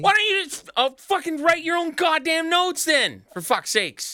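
loud, high-pitched outburst; exasperated yelling. Real, not acted. Snapping at co-host over the show notes, exasperated yell. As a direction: explode at him in exasperation.

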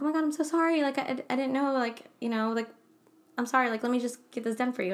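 high, rushed, flustered sorry; a customer rep shocked into apologizing; flustered apology. Retold or imitated. Telling a crying widow at the counter, flustered high apology.

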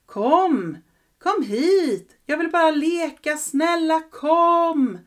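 high, loud calls from afar; calling out. Acted. Ghost boy calls the girl from the graveyard, high and loud. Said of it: "höra honom ropa".

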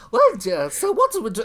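high falsetto grande dame voice; falsetto old dame. Retold or imitated. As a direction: trill it like a retired opera diva. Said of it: "she'd come on and she'd say".

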